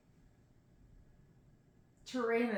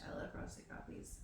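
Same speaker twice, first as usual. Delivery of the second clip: softer whispered aside; 13 dB quieter than usual. Real, not acted. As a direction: whisper it like a secret confession. Aside about her coffee.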